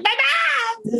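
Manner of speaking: high, shrill-free mock cry of my mom; hammed-up wail, voice jumping way up. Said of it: "and now you're like".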